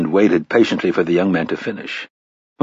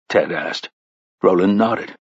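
usual speaking voice

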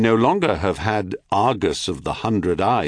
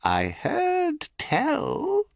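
harsh crone whisper; 4 dB quieter, much slower than usual. A narrator's reading. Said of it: "the old woman's voice lowered into a harsh whisper".

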